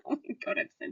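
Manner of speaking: whispered through embarrassed laughter; cringing at herself, breathless. Real, not acted. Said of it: "I can't believe I just did that".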